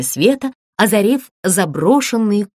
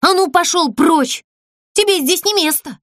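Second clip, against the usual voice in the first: high, sharp angry cry at the creature; angry shout; 9 semitones higher than usual. A narrator's reading. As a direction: chase it off, angrily. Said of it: "крикнула сердито Азалия". (сердитый окрик, высоко)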